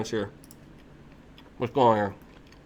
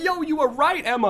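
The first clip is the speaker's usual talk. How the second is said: excited; 5 dB louder than usual. Retold or imitated. Excited realization in story.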